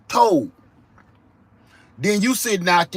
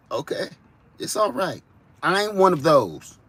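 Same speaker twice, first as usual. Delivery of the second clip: ordinary talk, nothing marked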